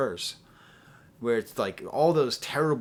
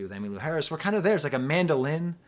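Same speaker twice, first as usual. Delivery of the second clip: ordinary talk, nothing marked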